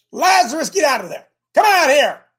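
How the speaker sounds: loud, high call into the tomb; commanding call to come out. Acted. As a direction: shout it out to a dead man in the grave. Jesus calls Lazarus from grave.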